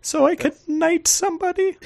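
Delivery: exaggerated aristocratic British accent; posh British. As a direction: play a pompous royal asking for a sub. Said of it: "That's spot on".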